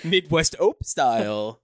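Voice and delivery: slow, drawled Midwest announcement; Midwest mom. Acted. Co-host names the ope bit, Midwest accent. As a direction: draw it out in a Midwestern voice. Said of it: "Except for the Midwest mom".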